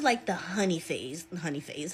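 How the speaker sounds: real verbal slip, words getting away; tongue-tied stumble. Real, not acted. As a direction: stumble on honey phase and trail off. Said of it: "Oh my God, I can't talk".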